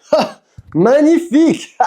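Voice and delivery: high, slow, drawn-out exclamation; exclaimed flourish. Real, not acted. Vlogger admiring the shirts, grand drawn-out exclamation. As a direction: sing out the praise with a flourish.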